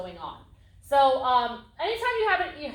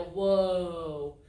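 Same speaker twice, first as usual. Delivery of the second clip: "Whoa" dropped low and drawn out; the right way: a deep, calm stop command; low steady whoa; 5 semitones lower than usual. Shown as a demonstration. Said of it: "if I'm asking my horse to stop and I go".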